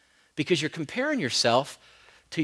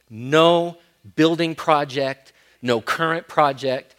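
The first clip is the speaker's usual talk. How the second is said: loud, slow, stressed listing; rising pulpit emphasis; emphatic build; 7 dB louder, slower than usual. Real, not acted. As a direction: hammer each project. Listing, sudden louder slow.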